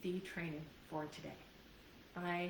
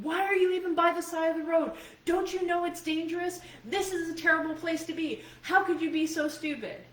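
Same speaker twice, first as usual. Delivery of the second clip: much higher and louder, fast furious scolding; raging yell; 10 semitones higher, 10 dB louder than usual. Pretend. Scolding a scared lost child, furious fast yelling. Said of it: "start yelling at them and raging".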